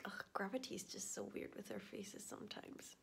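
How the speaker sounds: muttered whisper; soft whispered aside. Real, not acted. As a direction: whisper it to yourself.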